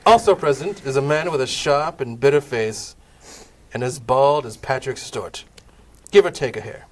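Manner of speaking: ordinary delivery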